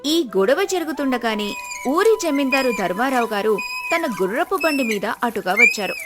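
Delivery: plain reading voice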